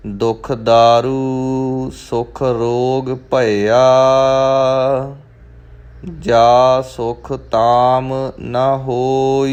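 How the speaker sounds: slow, drawn-out scripture reading with long line endings; Gurbani recitation. Shown as a demonstration. Teacher models the verse for learners, slow measured recitation. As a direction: recite it slowly, holding the final vowels.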